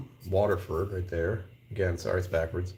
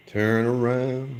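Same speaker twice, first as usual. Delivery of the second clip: "Turn around" stretched slowly, louder, with "around" long and pleading; drawn-out plea; 3 semitones higher, 4 dB louder, much slower than usual. Real, not acted. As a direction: coax the bottle slowly, dragging out "around".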